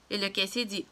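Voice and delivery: plain reading voice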